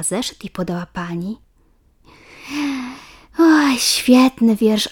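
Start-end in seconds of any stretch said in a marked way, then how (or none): 3.4-4.9 warm praise. A narrator's reading. Teacher praises the poem.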